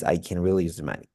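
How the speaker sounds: fast, low with weak "can"; middle can. Shown as a demonstration. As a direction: rush through "can". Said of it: "when it's in the middle".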